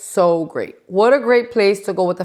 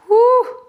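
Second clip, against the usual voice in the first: high whew; 5 dB louder than usual. Real, not acted. Relief after therapy memory.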